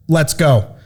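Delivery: normal talking voice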